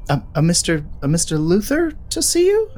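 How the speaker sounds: high, rising, polite announcing; secretary voice. Acted.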